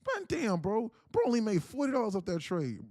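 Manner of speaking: high, loud imitation of complaining viewers; put-on high-pitched grumbling; mock critical viewer. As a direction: act out a viewer's loud disbelief. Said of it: "I know some people are thinking".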